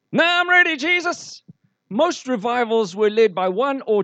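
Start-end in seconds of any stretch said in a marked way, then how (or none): 0.1-1.4 high, loud mock-eager plea. Preacher mocking passive churchgoers, high mock-eager plea. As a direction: lampoon the waiting believer, calling up to Jesus.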